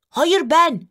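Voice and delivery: high, insistent protest; objecting. A narrator's reading. Brother objects to sister reading first, high-pitched protest. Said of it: "diyerek itiraz etti Bartu".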